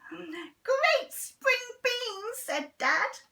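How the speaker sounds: very slow, high, drawn-out praise; Dad relishing the beans; delighted Dad. Acted. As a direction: savour each word.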